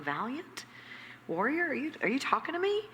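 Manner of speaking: high, quick, disbelieving squeak; incredulous Gideon. Acted. Gideon answering God's call, comically high and disbelieving.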